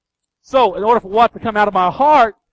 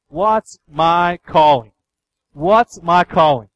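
drawn-out, louder, each word weighted; slow, emphatic question; 5 dB louder, much slower than usual. Retold or imitated. Preacher voicing what people ask him about life, slow and emphatic. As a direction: ask it slowly and forcefully.

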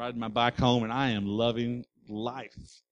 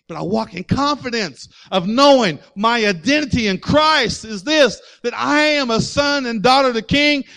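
rising to a shouted climax of the sermon; preaching at full force; 7 semitones higher, 9 dB louder than usual. Real, not acted. Preacher to congregation about their identity, building to a shout. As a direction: let it build until you're shouting it to the church.

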